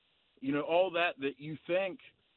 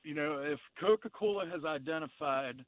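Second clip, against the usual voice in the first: normal talking voice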